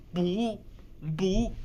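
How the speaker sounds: "不" said as rising second tone bú; tone change to bu. Shown as a demonstration. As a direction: let "不" rise before the fourth tone. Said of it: "it will change to".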